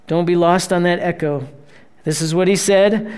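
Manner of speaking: ordinary delivery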